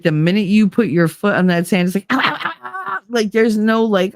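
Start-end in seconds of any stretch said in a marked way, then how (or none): 2.1-3.0 pained yelps. Retold or imitated. Hot sand burning bare feet.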